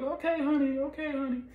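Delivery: high-pitched impression of the wife; wife's voice. Wife answering husband.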